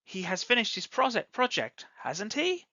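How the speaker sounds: "hasn't he" climbs high on "he"; rising intonation. Shown as a demonstration. Teacher models asking when we need an answer, rising intonation. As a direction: end on an upward, unsure question. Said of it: "If we go up".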